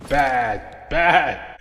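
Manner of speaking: hammed-up high, loud shouting; mock police shout. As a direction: yell like mock authorities busting someone. Mock authorities shouting at him.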